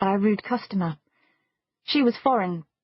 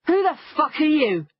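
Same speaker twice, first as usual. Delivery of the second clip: higher-pitched, aggressive demand; hostile challenge; 7 semitones higher than usual. A narrator's reading. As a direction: confront a stranger who butts in. Bully confronts the woman intervening.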